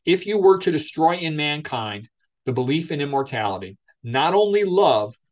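lightly voiced Nietzsche, unhurried and grave; solemn quotation reading. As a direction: read it like a weighty pronouncement. Reads Dostoevsky quote in debate.

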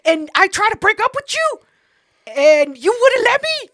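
high, loud, escalating mock argument; defensive accusation. Girlfriend reminds boyfriend of failed breakup, high loud accusation. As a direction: throw it back at them, voice climbing. Acted quarrel, defending herself.

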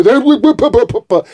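mock sputtering. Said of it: "that's how guilty people argue".

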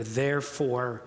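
slow, low, deliberate delivery; measured and weighty, with a pause before the last word; weighty emphasis. Real, not acted. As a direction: set each word apart, dropping low. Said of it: "this is what I want you to hear".

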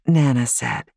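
usual speaking voice

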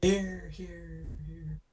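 high voice fading like an echo; high-pitched playful echo trailing off; mock echo. Echoing friend's shouted line, fading away.

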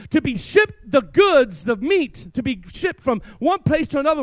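ordinary delivery

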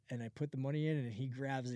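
ordinary talk, nothing marked

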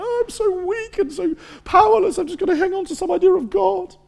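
very high, whiny caricature; mock feeble believer. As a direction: mimic a pathetic weakling in squeaky falsetto. Mock impression of weak believers.

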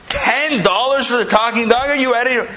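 high, hammed-up disbelief; incredulous. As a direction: question it as if it were absurd. Visitor incredulous at the low price.

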